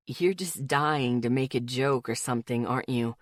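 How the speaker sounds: low, amused male voice; teasing smile. A narrator's reading. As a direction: tease her gently. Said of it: "hear his smile in his voice".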